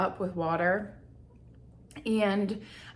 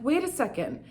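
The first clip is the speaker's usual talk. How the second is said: higher, startled wondering; sudden realization; 6 semitones higher than usual. Retold or imitated. To herself, linking headaches to emotions, surprised. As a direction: catch yourself mid-thought, amazed. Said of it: "I'm like".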